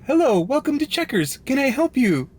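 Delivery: high, bright mock machine greeting; drive-thru AI voice. Driver imitating the AI drive-thru, high mock greeting. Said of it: "and it's like".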